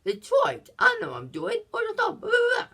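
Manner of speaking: high, loud whiny protest; mock outraged protest, rising high; acted quote. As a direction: squeal it out like a cornered hustler. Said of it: "he's like".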